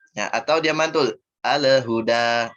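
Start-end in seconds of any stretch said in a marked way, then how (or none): 1.4-2.5 Al-huda with the l bounced, a little e after it; wrong: lam rebounds; bouncing lam. Shown as a demonstration. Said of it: "Nah, mantul".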